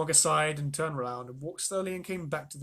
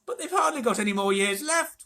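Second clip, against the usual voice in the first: louder, higher wailing; 8 semitones higher, 7 dB louder than usual. A narrator's reading. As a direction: wail in despair. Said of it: "wailed Grandpa Joe".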